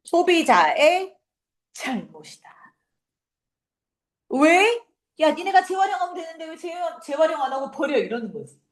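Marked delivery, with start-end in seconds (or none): 4.3-8.0 high-pitched mock scolding; accusing. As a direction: blame the customers, quick and high. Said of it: "누구 탓으로 돌리는 거야?".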